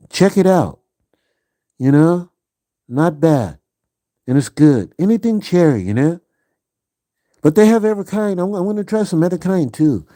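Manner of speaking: playful high goofy voice; silly voice. Pretend. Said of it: "Why am I talking like that?".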